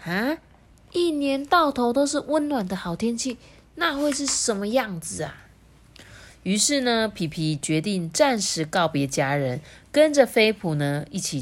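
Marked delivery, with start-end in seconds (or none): 0.0-5.3 higher, intrigued penguin voice; curious wondering. A narrator's reading. Penguin imagines a warm homeland, curious. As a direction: muse aloud with puzzled curiosity. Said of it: "引起了皮皮的好奇心".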